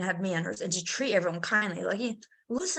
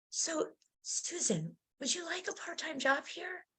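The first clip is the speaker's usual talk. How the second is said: gentle soft voice of Harry; 6 semitones higher, 12 dB quieter than usual. Retold or imitated. Harry's gentle question in vision.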